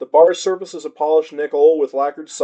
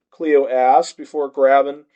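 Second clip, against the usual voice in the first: usual speaking voice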